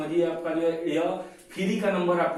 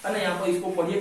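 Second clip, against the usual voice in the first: normal talking voice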